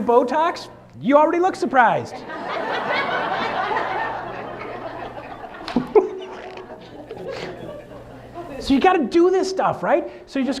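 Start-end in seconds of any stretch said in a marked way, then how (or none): 2.2-8.6 high laugh. Real, not acted. Laughs at Botox joke.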